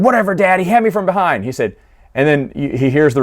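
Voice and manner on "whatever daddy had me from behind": imitated angry whine at dad; mock angry yelling. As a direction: protest like an indignant son, half-yelled. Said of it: "Bosk is all angry and yelling at".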